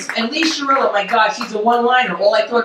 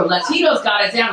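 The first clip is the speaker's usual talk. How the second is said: normal talking voice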